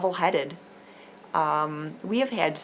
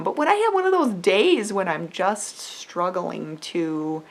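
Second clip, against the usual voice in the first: ordinary delivery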